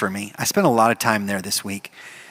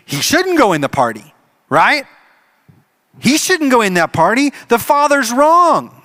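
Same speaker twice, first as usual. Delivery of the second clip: higher, louder, self-righteous protest; indignant objection; 10 semitones higher, 5 dB louder than usual. Acted. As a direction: insist the father's wrong.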